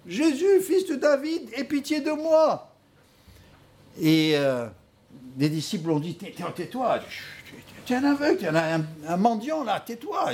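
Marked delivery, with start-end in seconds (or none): 0.1-2.7 high-pitched pleading cry. Retold or imitated. Blind beggar calls to Jesus for pity, crying out. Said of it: "il a commencé à crier". 6.2-10.3 imitated disciples shushing someone, pitch jumping high; hurried, stammering mock scolding; hushing rebuke. Disciples hush a shouting beggar.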